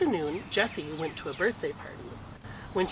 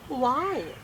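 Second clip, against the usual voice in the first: put-on little boy asking; 6 semitones higher than usual. A narrator's reading. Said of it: "her brother asked".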